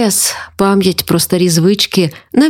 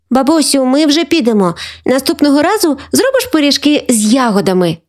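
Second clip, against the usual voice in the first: put-on higher, pleading child voice; little Тарасик; 6 semitones higher than usual. A narrator's reading. Grandson leaving grandmother, asking for berry pies, hopeful. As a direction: ask sweetly in a high small-boy voice. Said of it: "спитав Тарасик".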